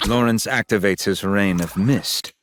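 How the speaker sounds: ordinary talk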